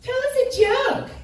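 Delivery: mock the annoying people asking for a joke; mocking impression. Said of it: "inevitably follows the same line".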